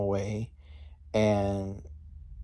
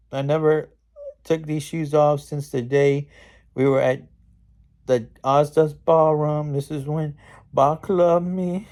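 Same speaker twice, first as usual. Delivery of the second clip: hammed-up wistful lament, raised and loud; upset East Witch; 5 semitones higher, 4 dB louder than usual. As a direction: pine melodramatically as the mocked witch. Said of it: "the East Witch is all getting upset".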